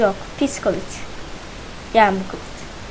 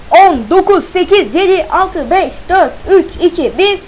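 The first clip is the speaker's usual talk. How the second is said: loud, high hyped countdown; excited countdown; 6 semitones higher, 5 dB louder than usual. Real, not acted. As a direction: count it down like a launch.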